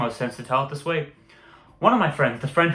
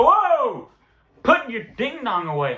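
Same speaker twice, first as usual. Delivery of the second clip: high startled yelp, then settling; startled outburst; 9 semitones higher, slower than usual. Retold or imitated. Teen startled by friend in locker room, high yelp. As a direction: recoil in mock alarm.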